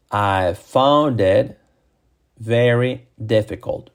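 very slow, separated words; slow model sentence. Shown as a demonstration. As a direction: stretch each word for learners. Same sentence, slowed for learners.

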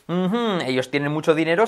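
ordinary delivery

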